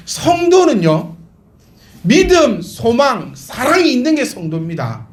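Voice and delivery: high, rising preacher's cry; impassioned preaching. Acted. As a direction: press each point home to the congregation. Preaching at full force. (열정적인 설교)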